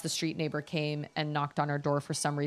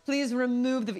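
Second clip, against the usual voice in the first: mock nosy neighbor; 3 semitones higher than usual. Imagined neighbor complaining about a car in the driveway, mock busybody. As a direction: scold like a hammed-up HOA busybody.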